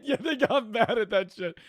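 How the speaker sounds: talking through real laughter, high and loud; words bursting out between laughs; laughing. Real, not acted. Laughing at the shout-outs.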